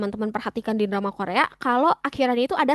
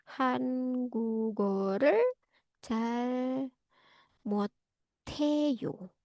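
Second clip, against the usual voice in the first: soft, drawn-out syllables with gaps between words; slow dictation; 7 dB quieter, slower than usual. Shown as a demonstration. Teacher writing the phrase for students, slow dictation. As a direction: sound out 한국어를 잘 못해요 slowly and quietly. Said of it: "Ini saya tulisin di chat box".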